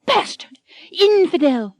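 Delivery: high, hammed-up insults; furious heroine. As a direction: spit the insults at him. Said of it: "Anne is still furious".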